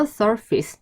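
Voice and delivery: "surface" with a short, weak "-fis" ending; correct surface pronunciation. Shown as a demonstration. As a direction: say "surface" as SUR-fis, not sur-face. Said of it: "다시". ("surface"를 "서피스"로 짧게 발음)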